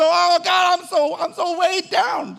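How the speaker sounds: high, loud, slow whining; 1 mock whining believer. Preacher mimicking a believer complaining to God, high drawn-out whine. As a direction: moan like someone crushed under weight. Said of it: "We go".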